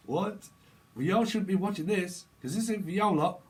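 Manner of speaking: high, loud telling-off; mock indignant scolding. Reader to viewers who shouldn't be watching, mock indignant.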